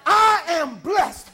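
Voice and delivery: the woman at the well, overjoyed and slowly proclaiming; joyful declaration. Acted. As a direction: lean on every word of I am blessed, slowly. Voicing the woman's joy.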